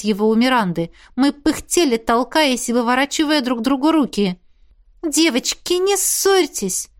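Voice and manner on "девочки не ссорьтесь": high, very slow soothing plea from Iris; high drawn-out plea to stop fighting; pleading plea. A narrator's reading. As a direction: coax the quarrelling girls gently. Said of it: "попросила Айрис".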